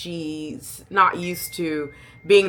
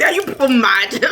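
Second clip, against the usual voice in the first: loud, higher exasperated challenge; mock outraged question; indignant; 7 semitones higher, 7 dB louder than usual. Real, not acted. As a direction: scold them in disbelief. Rant at dating show contestants.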